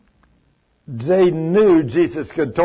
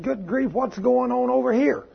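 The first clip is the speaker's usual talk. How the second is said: surprised; 7 semitones higher than usual. Retold or imitated. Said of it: "and I said".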